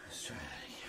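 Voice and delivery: soft unvoiced whisper. Real, not acted. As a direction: whisper it to yourself. Whispered aside.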